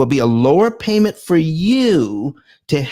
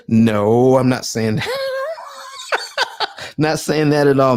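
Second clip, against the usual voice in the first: laughing through no, not that; laughing denial. Real, not acted. Host laughing at viewer's worried misreading, laughing denial.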